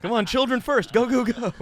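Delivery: loud, high hurry-up calls; urgent shouting. Pretend. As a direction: herd the kids in, yelling. Kidnapper herding kids into a van.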